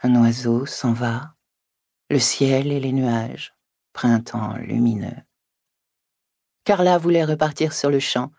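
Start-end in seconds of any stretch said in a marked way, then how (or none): 0.0-5.2 slow, solemn recitation; declaimed haiku. Acted. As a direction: recite it slowly like a grand poem. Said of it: "qu'elle avait déclamé".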